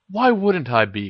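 plain reading voice

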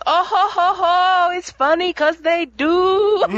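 Fawad's laugh. Retold or imitated. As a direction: chuckle slowly as you explain the joke. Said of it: "Fawad walks by and goes".

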